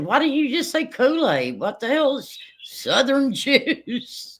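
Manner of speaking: high, slow disbelief breaking into a laugh; exasperated laughing question. Retold or imitated. Mom to son over the Kool-Aid, high laughing exasperation. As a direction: scold through a laugh. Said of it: "I said".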